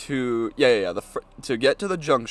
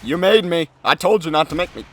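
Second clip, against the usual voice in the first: scolding; 4 semitones higher, 5 dB louder than usual. Real, not acted. Scolding intruder.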